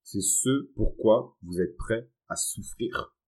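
each word slowly weighed; slow, deliberate emphasis; slow and emphatic. Real, not acted. Defining passion, emphatic.

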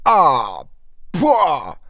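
spitting out something foul; disgusted cry. A narrator's reading. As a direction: exclaim in revulsion, pitch jumping up. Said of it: "S'écria-t-il en recrachant". (cri de dégoût en recrachant)

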